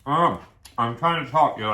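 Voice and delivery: talking with food in mouth; mouth full. Real, not acted. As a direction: speak through a mouthful of food. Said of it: "stuffing more food in my mouth".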